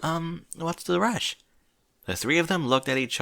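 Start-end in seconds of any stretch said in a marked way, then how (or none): 0.0-1.4 high, slow and timid; a shy pony hiding her confusion. A narrator's reading. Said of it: "trying to mask her confusion".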